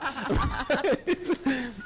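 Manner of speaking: high-pitched laugh; amused, high laughter. Real, not acted. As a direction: burst out laughing at your own remark. Laughs at own reported remark.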